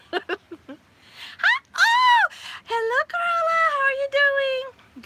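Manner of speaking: high, loud, drawn-out hello; light up and sing out the welcome; excited greeting. Real, not acted. Greeting a viewer who just joined, high and delighted.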